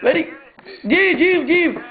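high, thrilled praise; high excited praise; mock delight. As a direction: cheer him on, overjoyed. Celebrating caller finally saying it.

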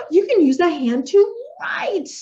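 eager exaggerated revelation; really excited. Shown as a demonstration. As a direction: gasp of discovery, high and loud. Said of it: "I'm gonna be making myself really excited".

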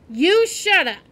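snapping back with a loud yell. A narrator's reading. Julian yelling back at Jack, loud retort. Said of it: "Julian yelled".

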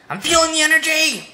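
high, loud buzzing energy; hyped up. Pretend. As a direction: bounce with the rush.

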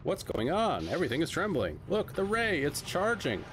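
earnest adventure hero, lightly voiced; adventurous hero voice. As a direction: narrate like a bold explorer.

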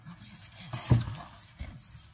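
awed whisper. Real, not acted. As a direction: lean in and whisper the question. Opening mystery gift.

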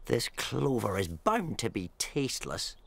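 scornful villain grumbling; low disdainful rat voice. Acted. Rat complains, low and quiet.

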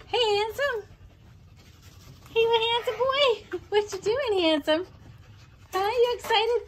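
excited squeaky dog-talk. Real, not acted. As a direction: gush over the dogs like babies. Cooing greetings to the dogs.

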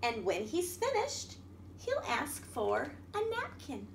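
lively picture-book reading voice; reading to children. A narrator's reading. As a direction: read it out to a room of little kids.

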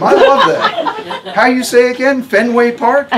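a tough, no-nonsense patient giving orders; Harmony's voice. A narrator's reading. Harmony delighted, laughing.

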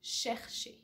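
chercher whispered softly, French sh sounds. Shown as a demonstration. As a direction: repeat chercher slowly in French. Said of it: "So I'm gonna say that again".

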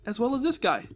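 normal talking voice

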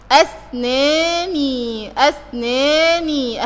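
"اسناني" drawn out very slowly, syllable by syllable; slow sounding-out of "اسناني" to show its ending; possessive ya demonstration. Shown as a demonstration. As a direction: stretch "اسناني" into as-naa-nii with a long final ee. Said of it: "اخرها ياء ملكية".

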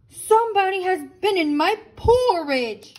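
hammed-up indignant big bear; Papa Bear indignant. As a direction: protest the eaten porridge as Papa Bear. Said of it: "said Papa Bear".

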